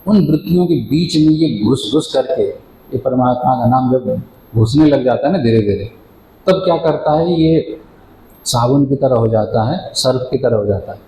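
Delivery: ordinary talk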